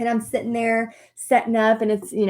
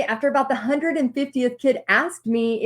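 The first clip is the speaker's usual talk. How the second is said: ordinary delivery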